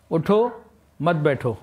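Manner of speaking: اٹھو alone with a lift, a break, then مت بیٹھو joined and falling; pause after اٹھو. Shown as a demonstration. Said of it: "اور یہی جملہ ایک اور انداز سے".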